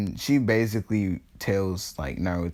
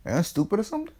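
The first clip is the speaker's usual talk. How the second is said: high mock disbelief; 10 semitones higher, much faster than usual. Real, not acted. Mocking the villagers.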